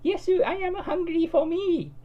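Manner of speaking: high, loud squeaky cartoon girl; high, loud cutesy anime girl. A narrator's reading. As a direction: chirp it out like an eager anime sidekick. Cud voiced in story.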